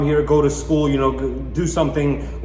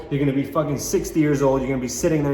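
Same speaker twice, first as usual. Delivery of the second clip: normal talking voice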